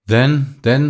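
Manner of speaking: high, halting repetition; stammering. A narrator's reading. Marguerite hears that he hopes to see her, stammering. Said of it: "stammered Marguerite".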